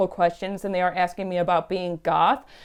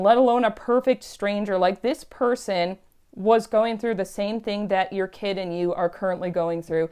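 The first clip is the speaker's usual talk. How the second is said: normal talking voice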